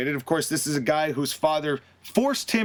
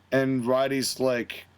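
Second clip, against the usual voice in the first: normal talking voice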